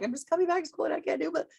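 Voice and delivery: high, fast, worried student voice; mock-nervous student, pitched high; anxious older learner. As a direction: fret like a returning adult student. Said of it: "older learners who are like".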